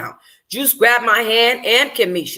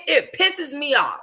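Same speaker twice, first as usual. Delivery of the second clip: usual speaking voice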